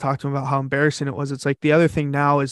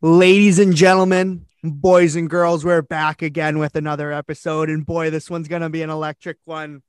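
ringmaster. Acted. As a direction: announce the guest like a circus act. Podcast guest intro announcer.

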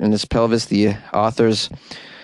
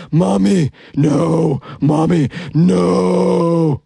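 higher, husky mock wail with a long drawn-out end; mock yell; 7 semitones higher, 3 dB louder, much slower than usual. Caller imitating her daughter's protest, higher mock yell. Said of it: "and hear her yell".

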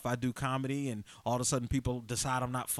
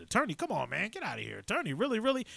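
incredulous scoff with high questioning jumps; mock disbelief; 7 semitones higher than usual. Doubters reacting to his becoming an attorney, mock incredulous dismissal. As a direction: lightly imitate doubters brushing you off.

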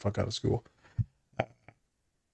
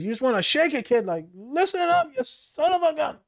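high, strained mock tirade building higher; comic fed-up outburst, slowing on the rise; mock exasperated scolding; 12 semitones higher than usual. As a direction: pretend to shake some sense into a kid. Acts out shaking a kid.

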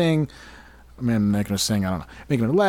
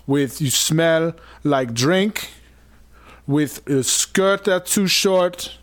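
put-on African accent, slightly higher; dramatic African guard reading; African security guard voice; 4 semitones higher than usual. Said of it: "my African security guard voice".

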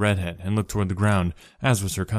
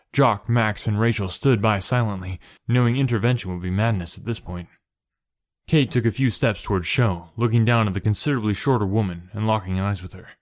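ordinary delivery, nothing marked

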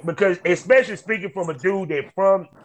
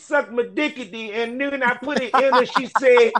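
high-pitched rapid comic rhyme; Dolomite; 7 semitones higher than usual. Acted. Host doing a Dolomite character for his guest, high rhyming patter. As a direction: rattle off a dirty rhyme in a raised, playful voice. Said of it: "Do a Dolomite character".

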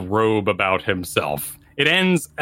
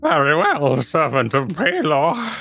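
put-on slow, slightly higher old man's reply; old hermit voice; 6 semitones higher, slower than usual. Old man agreeing to approach the servant of Pelor, slow aged voice. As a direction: answer grandly yet creaky.

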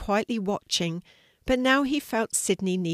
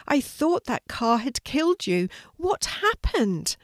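high-pitched, distressed, slowing on the question; worried exclamation; 6 semitones higher than usual. A narrator's reading. Sidney to his revived sister, worried high exclamation. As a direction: blurt it out, upset and high.

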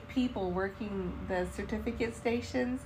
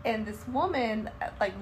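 usual speaking voice

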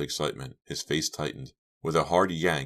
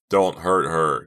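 loud, frantic plea; desperate loud cry; screamed plea; 8 dB louder, slower than usual. Acted. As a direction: scream to protect her. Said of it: "Chuck screamed".

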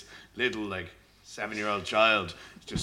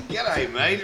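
higher, louder mock Australian greeting; Australian accent; 6 semitones higher, 5 dB louder than usual. Shown as a demonstration. Singer warning the English attempt will fail, Australian accent. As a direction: put on a broad Australian accent. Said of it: "It'll be like Australian".

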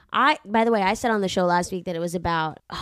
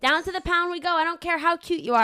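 high, playful fake threat with a laugh; pretend-scold a pet you adore; mock threat to the dog; 8 semitones higher than usual. Host teasing the growling dog, high mock threat.

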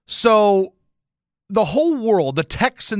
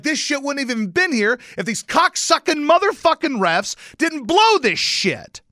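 high-pitched, reenacted tirade at referees; angry rant; 13 semitones higher, 4 dB louder than usual. Fan blaming refs for the loss, angry rant. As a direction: rail at the refs, stretching the last words.

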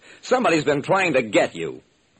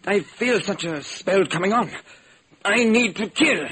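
higher, rising into a crazed threat; wild-eyed menace; 7 semitones higher than usual. Acted. Villain lunging at the detective, higher and wild. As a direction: let the madness climb as he lunges. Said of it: "He looked at me wildly".

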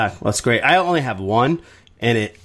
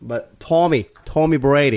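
put-on better Boston accent; 4 semitones higher than usual. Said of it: "Is that better?".

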